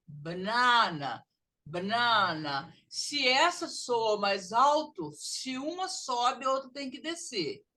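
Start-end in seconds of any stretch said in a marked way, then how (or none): 0.1-2.7 "banana" slow, with a long stressed NA in the middle; louder middle syllable. Shown as a demonstration. Teacher shows which syllable sounds louder, "banana" middle stressed. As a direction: lean hard on the middle of "banana", b'NAA-na. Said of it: "que soa mais alto".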